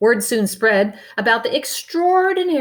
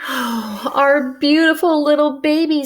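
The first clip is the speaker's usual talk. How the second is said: a high, louder, lightly sighed coo; sighing; 7 semitones higher, 5 dB louder than usual. Acted. Mother lifting her baby high, a light sighing coo. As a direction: coo over the baby with relief. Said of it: "sighed Missus Brains".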